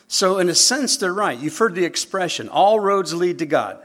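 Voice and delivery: high, loud preaching peak. Acted. Preacher to congregation, loud and high. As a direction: lift the room with it.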